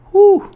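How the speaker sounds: high, loud overwhelmed exhale; a real rush of relief; loud whew. Real, not acted. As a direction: let it out like it just hit you. Overwhelmed by the answered prayer.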